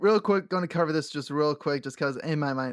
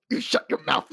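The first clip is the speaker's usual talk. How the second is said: laughing quote. Retold or imitated. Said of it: "you just hear her go".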